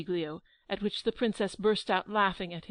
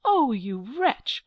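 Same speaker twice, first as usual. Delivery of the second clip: slow, louder outraged cry of wretch; angry cry; 4 semitones higher, 7 dB louder, much slower than usual. Acted. Angelica crying out at Giglio's insult, slow and loud. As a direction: drag out the insult in fury. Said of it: "cries Angelica".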